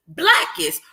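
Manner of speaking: ordinary delivery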